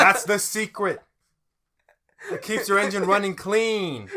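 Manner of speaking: high, loud showman revealing a secret; hammed-up salesman shout; brash pitchman. Attendant selling ramen-fuelled gas, loud brash pitch.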